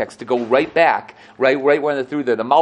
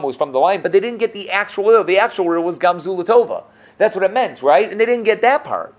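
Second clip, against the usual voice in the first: higher, driving teacher's emphasis; emphatic preaching; 7 semitones higher, 3 dB louder than usual. Acted. Teacher pressing the riddle point on students, emphatic and high. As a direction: hammer the point home to the class.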